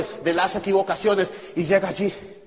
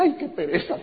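mock reluctant whine; 6 semitones higher, 4 dB quieter than usual. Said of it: "él decía".

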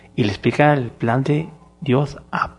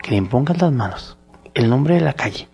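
ordinary delivery

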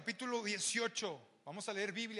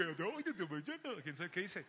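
King Julien impression; slower than usual. Preacher mimics King Julien from Madagascar, babbling. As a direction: lightly play the cartoon lemur king. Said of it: "El rey Lemur".

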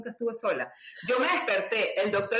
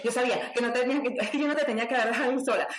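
fast, higher, self-reproaching rush; worried friend; 5 semitones higher, much faster than usual. Retold or imitated. Friend Nani on the phone, rushing in worried self-reproach. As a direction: blurt it out like a panicked friend.

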